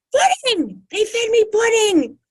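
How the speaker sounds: very high, loud cry of outrage; a big animated woman shouting her grievance; outraged woman. Retold or imitated. Patient cries to the doctor about hospital pudding, very high and loud.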